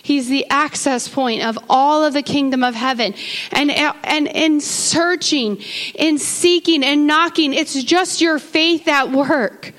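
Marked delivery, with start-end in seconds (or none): 3.5-9.7 slow, deliberate, loud sermon lines; slow emphatic preacher's stress; emphatic preaching. Real, not acted. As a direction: lean on each word for the congregation. Preacher stressing seeking and knocking.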